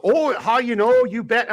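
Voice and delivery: high, slow, exaggerated Minnesota accent; hammed-up Minnesota singsong, pitched high; mock Minnesota accent. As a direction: stretch it out like a cartoon Minnesotan. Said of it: "I love it when people say".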